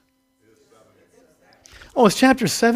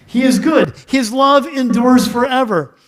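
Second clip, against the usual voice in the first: raised voice of joyful praise, slowed; loud praise; 5 semitones higher, 5 dB louder, slower than usual. A narrator's reading. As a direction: proclaim it like a crowd singing to the Lord. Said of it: "raised their voices in praise to the Lord and sang".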